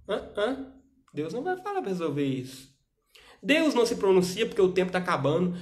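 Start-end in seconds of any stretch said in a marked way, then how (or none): 0.1-0.7 mock puzzled. 3.4-5.5 louder, higher preaching stress. Acted. Preaching point.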